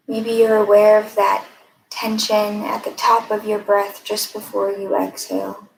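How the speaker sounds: calm, even guiding voice with long pauses; guided meditation. Acted. Mindfulness fellow leading a breathing exercise, guided meditation. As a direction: lead listeners gently inward, leaving room between phrases.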